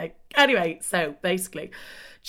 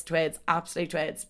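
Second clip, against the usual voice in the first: normal talking voice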